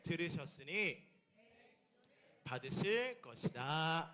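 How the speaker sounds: slow, low unison reading; group scripture reading. Real, not acted. Congregation reading scripture together, slow and low. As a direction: read the verse slowly together. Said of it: "함께 읽어봅시다".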